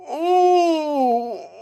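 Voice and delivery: high, long muffled "mmm"; mouthful moan. Retold or imitated. Imitating wrestler with too much ice lolly, muffled moan. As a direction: imitate moaning through too big a bite. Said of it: "he kinda takes too much. So he goes".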